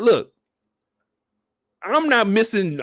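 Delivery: ordinary talk, nothing marked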